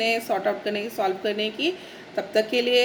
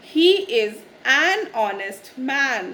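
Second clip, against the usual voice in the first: very slow, every word spaced: he is an honest man, honest with no h; slow model sentence; much slower than usual. Shown as a demonstration. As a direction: dictate it word by word for learners. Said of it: "यानी". (बहुत धीरे, एक-एक शब्द अलग करके)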